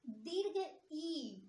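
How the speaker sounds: ई drawn out very slowly, held for two beats; a teacher stretching ई to show a long vowel; long vowel demo. Shown as a demonstration. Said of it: "वही आप बोलिए".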